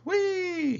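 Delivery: very high, gleeful train-whistle squeal; Thomas, whistling. A narrator's reading. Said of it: "he whistled".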